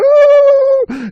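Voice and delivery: very high, loud "ooh"; long piercing chipmunk wail; frightened squeal. Acted. Terrified chipmunk facing bobcat, high frightened squeal. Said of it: "sitting there going".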